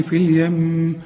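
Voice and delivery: اليم ending in a long hummed m; nasal hold on stop. Shown as a demonstration. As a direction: stop on اليم and hum its doubled m. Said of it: "عند الوصل وعند الوقف غنها".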